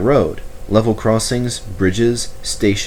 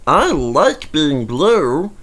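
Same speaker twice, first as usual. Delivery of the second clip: slow, drawn-out protest; a slow, hurt little protest; 7 semitones higher, much slower than usual. A narrator's reading. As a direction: plead to stay blue. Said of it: "protested Bert".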